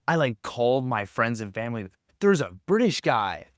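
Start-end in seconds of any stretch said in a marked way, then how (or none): 2.2-3.5 imitating his own thrilled call, pitched higher; excited announcement. Phoning friends and family about the British therapist, replayed with mock excitement. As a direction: blurt it out like big news.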